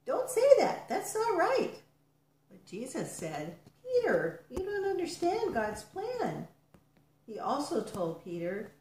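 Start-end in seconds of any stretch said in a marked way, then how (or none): 0.0-1.8 high, loud objection; raised-voice contradiction; arguing. A narrator's reading. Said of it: "People even tried to argue with Jesus". 3.8-6.5 high-pitched, earnest correction; storyteller's voiced rebuke; reproving. A narrator's reading. Jesus answers Peter's objection in story.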